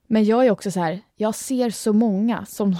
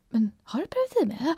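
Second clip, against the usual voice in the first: mother's question; 6 semitones higher, 4 dB quieter than usual. Retold or imitated. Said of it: "hon var så här".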